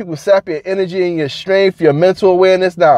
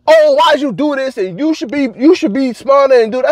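higher mock scolding boss; lightly voiced nagging boss, pitched up; imitating a boss who scolds; 8 semitones higher than usual. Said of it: "He called me up and was".

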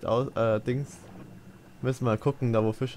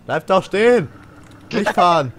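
loud urgent yell, higher; shouting; 6 semitones higher, 9 dB louder than usual. Real, not acted. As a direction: shout at the driver to stop. Yelling at driver to stop.

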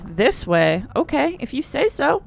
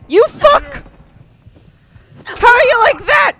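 raging yell; 14 semitones higher, 4 dB louder than usual. Real, not acted. Raging at enemies.

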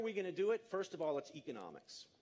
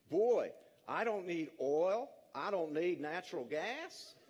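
put-on Midwestern farmer accent. Speaker voicing an Iowa farmer with a wind turbine, folksy Midwestern accent. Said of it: "forgive the accent".

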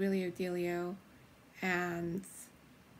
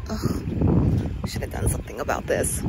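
cold groan; 7 semitones lower, 11 dB louder than usual. Real, not acted. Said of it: "It's so freaking cold".

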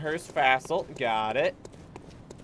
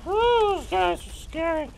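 loud high whoop, excited; 13 dB louder, slower than usual. Real, not acted. Gamer whooping after surviving a scary fight. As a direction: cheer with relieved glee.

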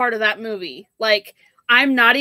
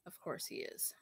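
much softer, whispered under the breath; whispered aside; 8 semitones lower, 29 dB quieter than usual. Real, not acted. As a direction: sigh it out as a private aside. Aside about her husband.

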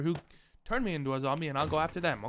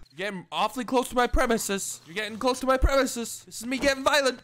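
higher-pitched, louder warning; mock threatening; 6 semitones higher, 5 dB louder than usual. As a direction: warn off an intruder, worked up and playful. Mock threatening the zombie closing in.

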